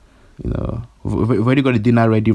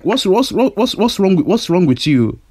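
exasperated; 6 semitones higher than usual. Acted. Exasperated shouting.

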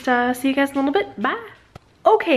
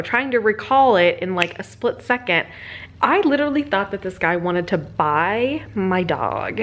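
normal talking voice

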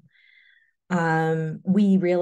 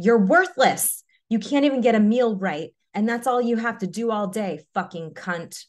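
raised, high-pitched tirade that drops off at the end; angry screaming; 5 semitones higher, 4 dB louder than usual. A narrator's reading. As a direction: hurl abuse in a narrated rage, easing down. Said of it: "He began screaming".

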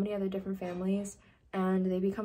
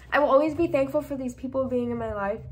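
tearful; 4 semitones higher, 4 dB louder than usual. Real, not acted. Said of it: "I'm gonna cry".

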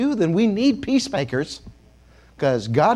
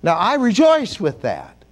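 usual speaking voice

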